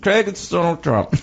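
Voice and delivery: put-on German accent. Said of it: "give him a German accent".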